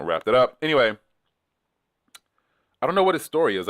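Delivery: ordinary delivery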